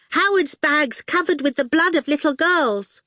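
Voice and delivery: put-on high, pert child voice; bossy little girl. A narrator's reading. Little sister orders the goon out, high and bossy. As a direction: boss him off in a high voice.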